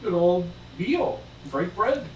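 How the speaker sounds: high, rousing call to the party; rally friends to a feast; hearty in-character invitation. Acted. Player speaks as hearty companion.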